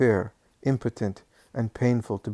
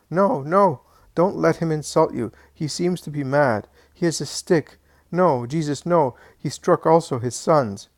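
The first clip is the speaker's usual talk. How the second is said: frightened pleading; 5 semitones higher, 5 dB louder than usual. Acted. Sobbing aunt begs Jesus stop.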